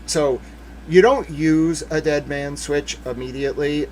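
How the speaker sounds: ordinary talk, nothing marked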